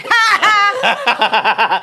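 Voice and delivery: a loud high ha ha, laughing for real; sudden loud laugh in the dark. Retold or imitated. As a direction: burst out laughing. Retelling her own laugh.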